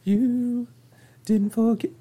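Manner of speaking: high, squeaky teasing; cartoonish mimicry; mock squeaky voice. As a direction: parrot him in a silly high voice.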